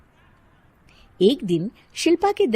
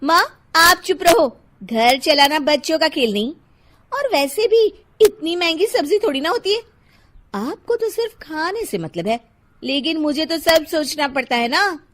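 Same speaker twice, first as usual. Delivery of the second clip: higher, defensive daughter-in-law; annoyed Shilpa; 7 semitones higher, 6 dB louder than usual. Acted. Daughter-in-law silencing her mother-in-law, irritated and higher. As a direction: talk back irritably and justify yourself.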